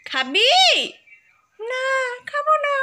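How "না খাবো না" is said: high, playful teddy refusing; teddy bear voice. Pretend. Mother voices the teddy bear refusing the banana, high and playful. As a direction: squeak it like a stubborn toy bear. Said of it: "ওই যে খাবো না বললো".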